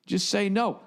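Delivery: tossing off the question with a laugh in it; amused Ralphie. Retold or imitated. Ralphie's punchline advice.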